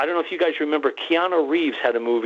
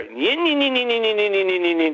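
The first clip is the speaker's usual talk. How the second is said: high-pitched whiny "yin-yin" engine noise, sliding down; dirt bike engine; 6 semitones higher than usual. Retold or imitated. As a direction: imitate a little dirt bike buzzing closer. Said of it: "a lot of kids ride dirt bikes".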